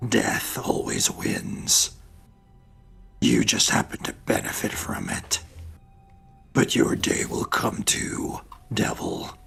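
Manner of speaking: defiant. Acted. Grom defies the devil.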